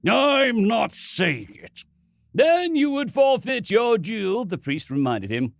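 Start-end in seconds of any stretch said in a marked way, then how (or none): 0.1-1.8 slow seething refusal. Acted. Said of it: "Ronson seethed". 2.3-4.4 solemn priest. Acted. Priest reminds sternly.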